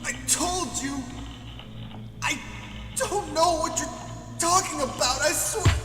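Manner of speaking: terrified victim. Acted. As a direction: beg desperately, voice way up. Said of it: "voice acting of the, uh, victim".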